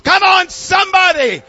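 high, shouted sermon climax; full-force preaching. Real, not acted. Preacher rousing the congregation after the story's punchline, full-force preaching.

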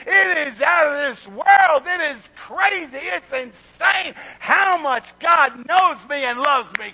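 loud, high, slow shout of wonder; real excitement, shouted; excited shouting. Real, not acted. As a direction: preach it out at full force. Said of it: "you're getting me excited".

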